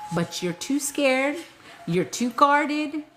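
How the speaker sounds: high slow emphasis on scared, guarded. Real, not acted. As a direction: stretch scared and guarded slowly. Emphasizing fear.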